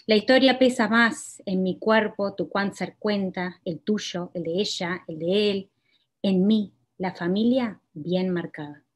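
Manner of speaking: measured, deliberate poetry reading; poem reading. Acted. Poet reading her own poem to the audience, measured.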